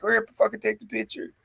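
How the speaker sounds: fast anxious urging; nervous hurry. Retold or imitated. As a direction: rush them like you're holding a live snake. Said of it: "I'm like".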